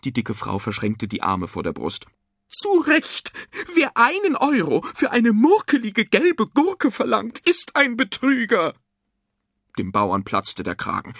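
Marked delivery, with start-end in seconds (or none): 2.5-8.7 high, loud indignant woman; shrill scolding. A narrator's reading. Fat woman accusing the farmer of cheating, shrill scolding. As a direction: lash out in a very high nagging voice.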